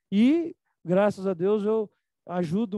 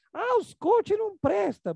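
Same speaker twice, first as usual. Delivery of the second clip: high, slow, scornful mock of a critic; 11 semitones higher, slower than usual. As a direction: parody the people who say coaches are worthless. Mocking people who bash coaches. (imitando crítico de coach com deboche)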